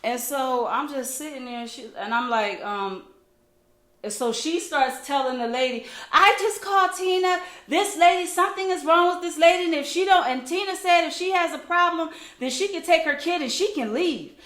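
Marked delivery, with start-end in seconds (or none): 6.1-14.3 high, raised mimicked complaint; indignant imitation. Woman tells the coach about the mother, imitated indignant complaint. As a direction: imitate a woman loudly telling on someone.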